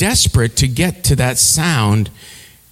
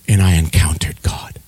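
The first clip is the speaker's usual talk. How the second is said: hushed awe; 9 semitones lower than usual. Real, not acted. Awed memory of meeting God.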